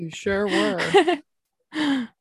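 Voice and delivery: slow high teasing; teasing drawl. Acted. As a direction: tease them knowingly. Teasing partner about being high.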